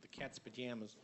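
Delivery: quiet attempt at a Canadian accent; Canadian-style "pajamas", middle vowel as in "jam". Shown as a demonstration. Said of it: "with a Canadian accent".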